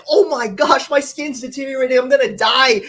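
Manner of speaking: high, hammed-up panic over dying skin; mock panic. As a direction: squeal it like a mock melodramatic panic. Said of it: "I could get behind that more than".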